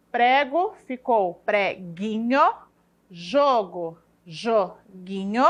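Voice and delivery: each word read out slowly with pauses; slow word list. Shown as a demonstration. Teacher reading examples to pupils, slowly. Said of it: "teacher reads example word list".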